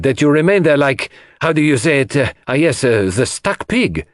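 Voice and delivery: exclaim, then hunt haltingly for the idiom; Poirot, crying out. A narrator's reading. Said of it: "he cried".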